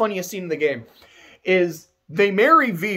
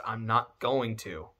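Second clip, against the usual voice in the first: deadpan Zidane; 10 semitones lower, 11 dB quieter, slower than usual. Retold or imitated. Zidane voiced, flat refusal.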